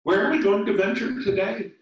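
high, loud and fast; high fast excited little bug; eager friend. Acted. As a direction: bubble over with eagerness. Molly voiced, eager.

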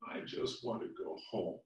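soft, low and defeated; sad. Acted. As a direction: quietly give up. Lindy scared and crying.

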